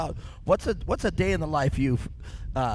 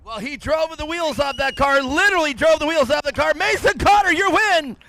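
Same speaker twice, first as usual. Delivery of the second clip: high, loud shouted finish call; excited announcer at full shout; race winner announcement; 13 semitones higher, 9 dB louder than usual. Real, not acted. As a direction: build to a roaring winner's call.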